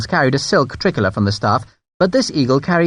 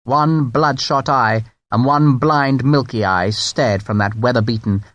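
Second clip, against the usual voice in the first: ordinary delivery, nothing marked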